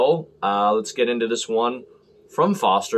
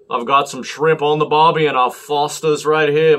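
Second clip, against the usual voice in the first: badly faked Aussie accent, raised and loud; terrible Australian accent; 4 semitones higher, 6 dB louder than usual. Reviewer showing off his beer in a terrible Australian accent. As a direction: put on a bad Australian accent, loudly. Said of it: "My accent is terrible".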